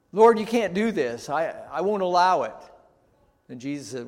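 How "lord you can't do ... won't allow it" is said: loud, high, indignant protest; proud refusal. Retold or imitated. Preacher voicing Peter refusing to let Jesus wash him, indignant. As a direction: put your foot down with Jesus.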